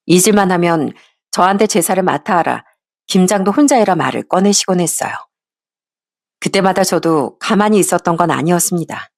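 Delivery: plain reading voice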